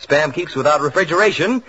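ordinary delivery

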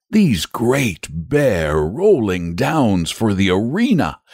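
high, drawn-out coaxing; persuasive. A narrator's reading. Saint George pictures the arena for the dragon, persuasively. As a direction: paint it temptingly, lingering on the words. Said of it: "he began again persuasively".

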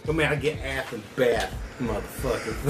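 soft, low-key rapper swagger. Acted. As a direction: trail down low on the last word. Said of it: "talks like [name]".

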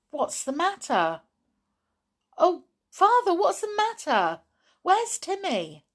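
high, loud worried questions; alarmed. Acted. Girl running to her silent, slumped father, alarmed. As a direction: plead anxiously for an answer.

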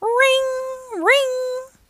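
high, sustained singsong ring; imitated alarm bell. A narrator's reading. Firehouse alarm calls the trucks out, high ringing imitation. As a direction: hold each ring on one high note.

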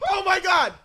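usual speaking voice

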